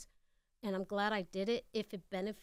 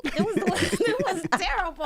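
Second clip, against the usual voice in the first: laughing; 10 semitones higher, 12 dB louder than usual. Real, not acted. Recalling a humiliating audition.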